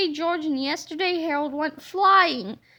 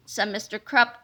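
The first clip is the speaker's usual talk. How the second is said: plain reading voice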